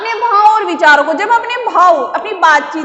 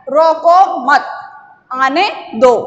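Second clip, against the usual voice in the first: "रोको मत" together, brief pause, then "आने दो"; pause after मत; 4 dB louder, much slower than usual. Shown as a demonstration. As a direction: demonstrate the comma after मत. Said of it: "थोड़ा सा हम रुकें".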